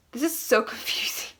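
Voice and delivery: laughing under breath; breathy amused whisper. Real, not acted. Laughing whisper over diary.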